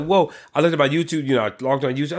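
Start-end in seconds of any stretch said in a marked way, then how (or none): 0.0-0.3 surprised whoa. Real, not acted. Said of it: "I was like".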